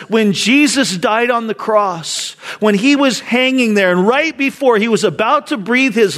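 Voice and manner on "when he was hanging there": ordinary talk, nothing marked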